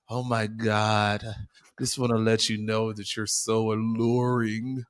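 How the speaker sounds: slow, drawn-out tipsy compliment; sloshed admirer. Retold or imitated. Co-host imitating a drunk admirer at a party, slow tipsy gushing. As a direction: imitate a sloshed man savoring every word. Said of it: "that's how he said it".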